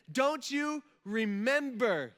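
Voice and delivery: slowly jog their memory as an eager angel; pumped angel. Pretend. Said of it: "this angel's probably pretty pumped".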